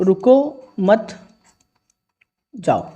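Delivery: रुको मत held together, long pause, then जाओ; pause after मत. Shown as a demonstration. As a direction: break the phrase just before जाओ. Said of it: "विराम चिन्ह का जगह, स्थान बदल दिया".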